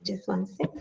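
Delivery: whispered off to the side; quick whisper to someone nearby; whispered aside. Real, not acted. Aside while interrupted.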